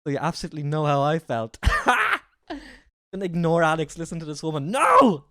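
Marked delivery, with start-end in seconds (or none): 1.6-2.2 sudden high-pitched laugh; high burst of laughter. Real, not acted. Reactor laughing over the accent video, high cackle. 4.7-5.2 high, loud outburst; emphatic refusal. Real, not acted. Reactor refusing to listen to the coach, loud protest.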